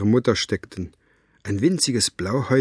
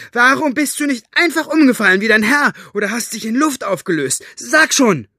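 high, loud, angry demand; outraged high shouting; screaming at him; 13 semitones higher, 7 dB louder than usual. A narrator's reading. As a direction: yell the questions, demanding an answer. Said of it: "schrie Elinor ihn an".

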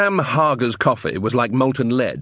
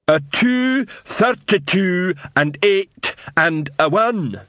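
slow, high, dim troll counting bricks; 7 semitones higher, much slower than usual. A narrator's reading.

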